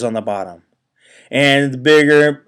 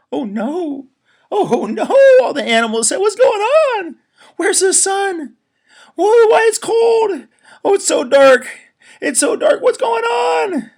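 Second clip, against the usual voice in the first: high, frantic animal voices; panicked forest animals; 4 dB louder than usual. Acted. Forest animals crying out in the sudden dark, panicked. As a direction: squeal it out like scared little critters.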